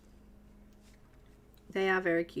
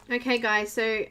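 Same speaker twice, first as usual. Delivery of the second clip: normal talking voice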